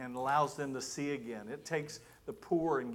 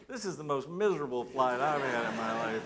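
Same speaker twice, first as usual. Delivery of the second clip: exasperated inner thought; 6 dB louder than usual. Retold or imitated. Pastor thinking to himself after seatmate's praise, comically exasperated. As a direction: counter the praise with an emphatic, miserable aside. Contrary private thought about same flight.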